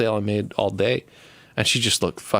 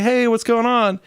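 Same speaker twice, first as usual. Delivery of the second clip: much higher, bright mock-friendly hello; chirpy greeting; 3 dB louder than usual. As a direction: sing out the hello way up high. Greeting an ex in bodega.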